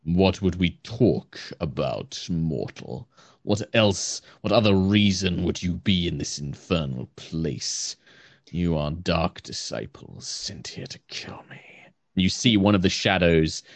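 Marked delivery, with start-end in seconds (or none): 0.0-11.6 low, hushed accusation from a celestial being; brooding otherworldly figure, accusing; suspicious weary celestial. Acted. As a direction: sink lower and softer as the suspicion builds. Game master voices suspicious celestial.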